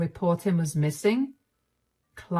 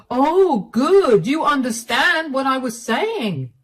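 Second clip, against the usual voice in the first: louder, sneering "good"; mocking husband; 7 semitones higher, 6 dB louder, slower than usual. Clive sneering at his wife about the affair, louder mocking tone. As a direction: rub it in with mock approval.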